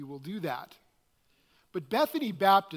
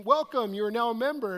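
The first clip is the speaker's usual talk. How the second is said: higher, louder mock email announcement; cheery welcome email; 5 semitones higher, 5 dB louder, faster than usual. Church email greeting a surprised new member, mock announcement. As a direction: read out like an automated welcome, pitched up. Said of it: "we got the email saying".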